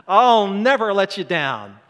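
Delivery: loud, high, cocky boast; brash loud bragging disciple; bravado. Retold or imitated. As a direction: puff up like Peter swearing loyalty. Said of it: "He's all bravado".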